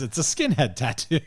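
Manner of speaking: ordinary delivery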